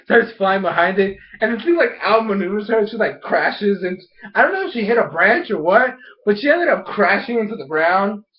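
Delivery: ordinary talk, nothing marked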